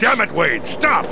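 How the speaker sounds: sharp high yell to stop him; angry shout. Acted. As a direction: bark the order, furious and high. Cable yells at Wade to stop.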